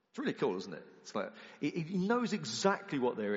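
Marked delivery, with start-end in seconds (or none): none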